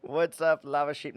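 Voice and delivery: loud hammy pirate greeting; accidental pirate voice. Pretend. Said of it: "Now you're a pirate".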